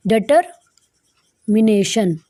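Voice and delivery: "Determination" very slowly, split by a long pause after "deter"; slow syllable breakdown. Shown as a demonstration. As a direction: dictate "determination" in drawn-out chunks for learners. Teacher spells out the new word slowly. ("Determination" को तोड़-तोड़कर, बहुत धीरे बोलें)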